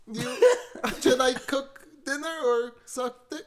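high mock Filipino accent; hammed-up Filipino wife voice. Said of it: "that's how it usually goes".

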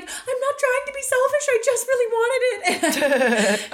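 put-on high, slow pleading child voice; whiny kid voice. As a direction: plead like a heartbroken little kid. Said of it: "I remember even being like".

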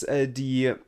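normal talking voice

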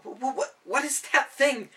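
high, stammering fright; shell-shocked stammer. A narrator's reading. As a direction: stutter it out in shock. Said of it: "shell-shocked from Dunder's boom".

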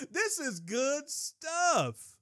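high, slow, drawn-out relish; savouring it in a high, excited voice; delighted exclamation. Real, not acted. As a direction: stretch each word out with glee. Preacher delighted by his own point.

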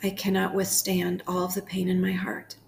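unhurried prayer with long pauses between phrases; guided prayer meditation. Acted. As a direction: pray it phrase by phrase, leaving room to repeat.

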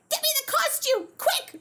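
very high, hammed-up frantic demand; frantic urgency. Singer hurrying her costume assistant, acted frantic urgency. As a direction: rush them as if seconds count.

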